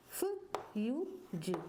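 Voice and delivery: फ़ यू जी, each sound separated, very slow; फ़ यू जी said sound by sound, very slowly; slowly spelled out. Shown as a demonstration. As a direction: break फ्यूज into its pieces, slowly. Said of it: "अगले वर्ण के बिना हम आधे अक्षर को नहीं बोल सकते".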